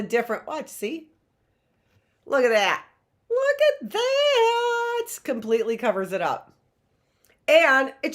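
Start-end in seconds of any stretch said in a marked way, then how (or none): 3.3-5.1 slow, high, stretched-out squeal of delight; stretched, high excited shout. Real, not acted. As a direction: coo over it like a proud maker. Delighted showing her craft.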